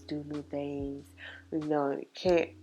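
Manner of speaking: normal talking voice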